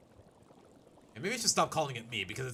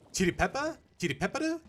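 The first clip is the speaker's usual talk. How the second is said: put-on higher questioning Japanese accent; 8 semitones higher, 5 dB louder than usual. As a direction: ask it twice in a Japanese accent. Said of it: "Japanese they do pronounce the R".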